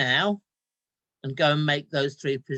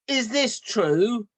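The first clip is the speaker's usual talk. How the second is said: mild stern anger; 6 semitones higher, 6 dB louder, much slower than usual. Acted. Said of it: "She only calls me young man if she's very mad".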